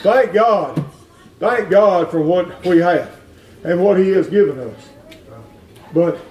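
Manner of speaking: ordinary talk, nothing marked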